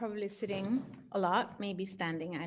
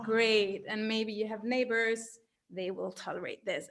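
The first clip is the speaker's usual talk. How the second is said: ordinary talk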